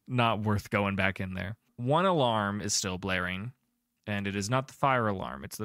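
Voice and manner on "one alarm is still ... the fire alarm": ordinary talk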